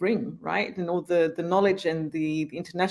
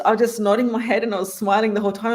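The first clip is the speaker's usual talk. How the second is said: normal talking voice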